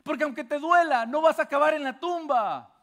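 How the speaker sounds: más agudo y fuerte, con fervor; proclámalo como predicador encendido; predicación a toda voz. Acted. (predicación fervorosa)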